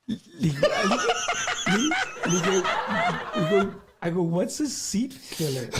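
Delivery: words broken up by laughter, slowed; can't get through it for laughing; laughing while telling. Real, not acted. Retelling the seat filler mix-up.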